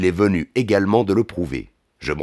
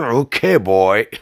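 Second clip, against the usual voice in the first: higher-pitched reply through a fit of coughing; 5 semitones higher, 4 dB louder than usual. A narrator's reading. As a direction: splutter the words out between fake coughs. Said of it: "réponds-je au milieu d'une quinte de toux". (réponse en pleine quinte de toux)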